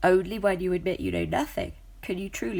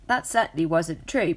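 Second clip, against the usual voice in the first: plain reading voice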